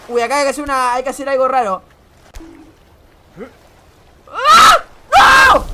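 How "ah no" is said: high, loud shriek of alarm; sudden panicked yell; startled scream. Real, not acted. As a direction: scream as if slipping. Slips in the climbing game.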